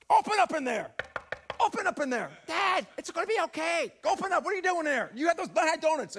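loud, high mock shout at the son; shouting through a door. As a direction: bang on the door and yell. Dad banging on son's door.

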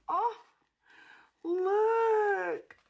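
high, loud, emotional squeal; delighted squeal. Real, not acted.

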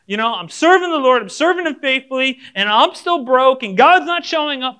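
loud, high, aggrieved protest; mock indignant complaint. Believer griping that God is not showing up, mock indignant. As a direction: pile up the grievances, loud and high.

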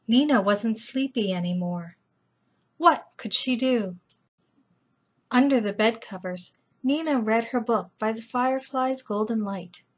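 plain reading voice

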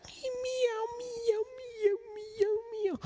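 very high, soft little meows; kitten meows. Acted. Reader voicing the cat for children, tiny high meows. Cat sounds in kids' book.

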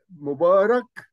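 "مبارك" said high and loud; stressed Arabic gloss. Shown as a demonstration. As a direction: announce the Arabic meaning firmly. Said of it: "بالعربي معناه". ("مبارك" بصوت عالٍ واضح)

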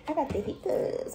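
high, soft whiny complaint; mock whiny. Repeating how someone said it, in a high soft whine. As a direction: pout it out, mildly whining. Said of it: "why you saying it like, like that?".

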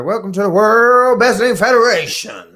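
loud, high-pitched wrestling announcer call; Mean Gene Okerlund. Acted. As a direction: belt it out like opening a wrestling broadcast. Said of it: "trying to sound like Mean Gene Okerlund".

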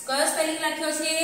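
ordinary delivery

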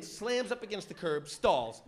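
normal talking voice

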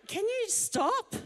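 pleading whine. Retold or imitated. Complaining person, quoted.

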